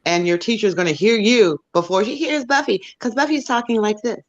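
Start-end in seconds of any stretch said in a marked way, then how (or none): 2.0-4.2 light, higher voice that doesn't carry; Buffy voice. Shown as a demonstration. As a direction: lightly imitate a girl's non-resonating voice. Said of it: "Buffy's voice is not resonating".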